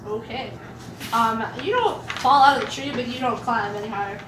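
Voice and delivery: normal talking voice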